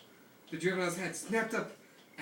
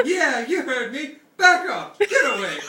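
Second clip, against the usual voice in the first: loud defiant shouting with laughter; high, loud yells at a dinosaur; 7 semitones higher, 14 dB louder, slower than usual. Acted. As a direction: bellow it, half laughing. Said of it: "Regis was shouting".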